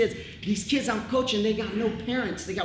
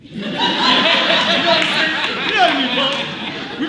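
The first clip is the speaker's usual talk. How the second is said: laughing; 7 semitones higher, 10 dB louder, slower than usual. Real, not acted. Laughing about his friend spitting.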